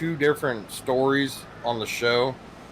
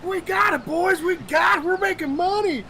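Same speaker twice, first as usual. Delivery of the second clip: excited shout. Retold or imitated. Captain cheering his crew on the radio, excited shout. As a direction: celebrate over the microphone. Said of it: "he's like".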